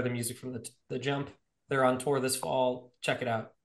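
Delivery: ordinary talk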